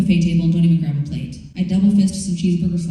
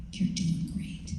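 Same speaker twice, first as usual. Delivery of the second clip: You're doing great breathed out slow and soft as a whisper; whispered soothing; 11 dB quieter, much slower than usual. Retold or imitated. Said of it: "She strokes my hair".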